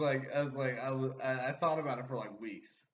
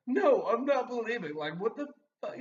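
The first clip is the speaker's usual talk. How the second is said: indignant denial; 10 semitones higher, 6 dB louder than usual. Retold or imitated. Denying to mom.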